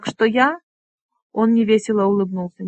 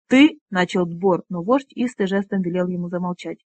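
ordinary delivery

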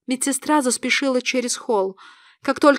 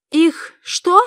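shocked, higher-pitched question; louder, stunned query with a gap; forcing words out; 5 semitones higher, 5 dB louder than usual. A narrator's reading. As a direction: squeeze it out in halting disbelief. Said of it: "выдавила я".

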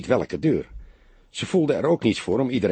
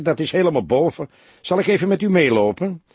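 higher, eager busybody voice; busybody neighbour offering to walk them up, eager; 7 semitones higher, 4 dB louder than usual. A narrator's reading. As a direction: offer help too keenly.

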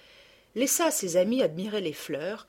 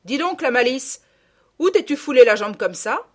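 loud, raised-pitch interrogation; angry country mother questioning; stern scolding; 6 semitones higher, 11 dB louder than usual. A narrator's reading. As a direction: demand an explanation from your child. Mother interrogates her naughty daughter.

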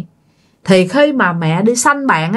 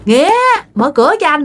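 shrill calling; 9 semitones higher than usual. Acted. Voice posing as the husband calls his wife from outside, shrill. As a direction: call through the door, voice pitched high. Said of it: "giọng của ông ngoại cứ lanh lảnh kêu vô".

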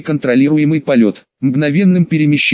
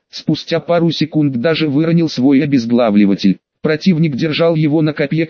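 plain reading voice

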